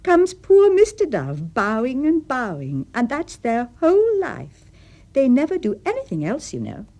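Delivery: high, loud, animated lilt; crying out. A narrator's reading. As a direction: draw the words out with playful relish. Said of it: "cried Anne".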